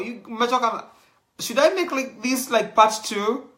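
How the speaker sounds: excitedly asking the audience, racing ahead. Real, not acted. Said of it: "excited host asking audience".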